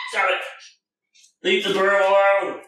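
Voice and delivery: ordinary delivery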